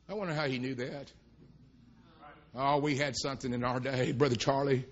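low, soft aside; quiet aside. Real, not acted. Preacher to Brother Charlie, quiet aside. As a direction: drop right down, low and soft.

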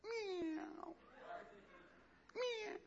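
high, soft little meow; a scared kitten mewing; timid kitten meow. Pretend.

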